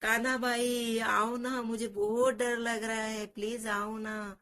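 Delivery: crying call for help. Acted. Frightened child calling for Kanha to come, drawn-out and pleading. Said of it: "जोर से आवाज देता है".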